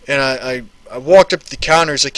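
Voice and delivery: normal talking voice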